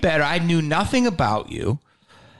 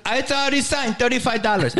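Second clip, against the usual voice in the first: high-pitched imitated hard-sell club owner; Jamie Masada; 7 semitones higher than usual. Club owner selling signed DVDs, imitated high insistent voice. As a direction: push the sale in a mock high voice. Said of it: "And he goes".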